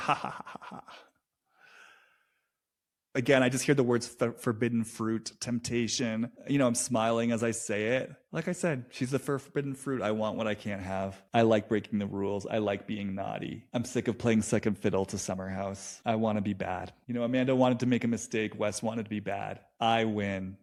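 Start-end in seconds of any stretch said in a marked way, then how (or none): 0.1-0.8 breathy villain cackle; evil laugh. Retold or imitated. Villain's laugh after reveal. 10.0-16.9 low naughty voice. Retold or imitated. Voicing West's inner wants.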